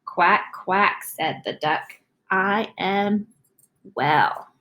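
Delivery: slow, expressive storybook reading; slow picture-book read-aloud; reading to children. Acted. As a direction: read it slowly for young listeners.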